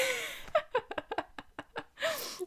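high, quiet fit of laughter; helpless laugh. Real, not acted. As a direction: laugh softly up high after a failed exercise. Cracks up after failed breathing exercise.

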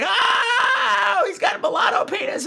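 high startled shout; mock shout. Blurting about the dildo, a high startled shout. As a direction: blurt it out in shock. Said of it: "And I'm like".

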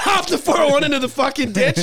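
words through laughter; laughing narration. Real, not acted. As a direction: laugh while telling it.

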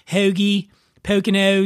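fronted "eh-oo" O in "Hoagie" and "Poconos"; Philly O's. Shown as a demonstration. Host demonstrates the big tell, Philly O's. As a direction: slide each O in Hoagie, Poconos from "eh" to "oo". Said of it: "the O's are the big tell".

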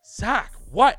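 high, loud questioning yelp; loud, high comeback; retold indignant reply. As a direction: imitate an indignant "what", voice jumping up. Retold startled reply to the nickname.

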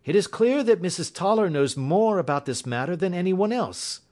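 high-pitched detective deduction; Holmes, keenly interested. Acted. Voices Holmes in story.

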